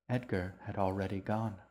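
plain reading voice

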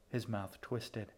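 ordinary delivery, nothing marked

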